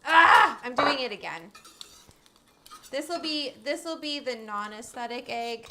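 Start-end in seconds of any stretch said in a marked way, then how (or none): 0.1-0.6 loud sharp cry of pain; pained yelp. Real, not acted. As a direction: yelp as the hand burns. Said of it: "I burnt my hand".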